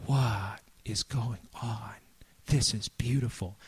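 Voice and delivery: slow, breathless whisper of wonder; awed whisper. Retold or imitated. Trembling disciples staring into the canyon, slow awed whisper. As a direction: hush your voice as if at a cliff edge.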